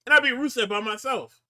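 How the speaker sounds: high, defensive whine; whiny Dom. Retold or imitated. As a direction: plead your innocence up high.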